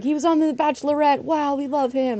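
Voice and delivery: high mock fan enthusiasm; gushing uncle. Imitating uncle explaining Bachelorette fame, high gushing voice. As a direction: imitate a star-struck relative gushing. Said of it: "my uncle kind of freaked out".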